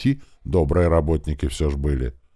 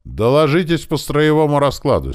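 higher, louder official demand; commandant's order; 12 semitones higher, 6 dB louder than usual. A narrator's reading. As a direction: address the parade as commander. Commandant demands a report on parade. (командный тон коменданта)

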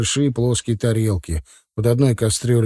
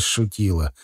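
usual speaking voice

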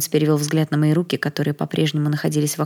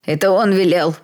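desperate, raised-voice accusation; strained yelling; wailing louder; 3 semitones higher, 4 dB louder than usual. A narrator's reading. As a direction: cry it out in panic. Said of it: "ещё громче завопил Тед".

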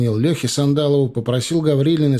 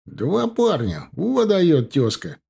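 slow, drawn-out words at a higher pitch; marvelling; 6 semitones higher, much slower than usual. Acted. Husband marvelling at his namesake's two boys, marvelling. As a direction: savour the amazing news in admiration.

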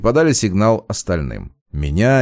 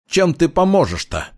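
high, challenging question; flaring up; 8 semitones higher than usual. A narrator's reading. Patrolman doubting a suspect can help, high heated challenge. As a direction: challenge with a touch of temper, pitched high. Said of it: "вспыхнул в ответ патрульный".

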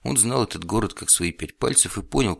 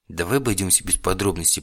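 plain reading voice

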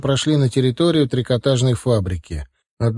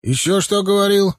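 demanding; 8 semitones higher than usual. A narrator's reading. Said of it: "требовательно спросил Ахмат".

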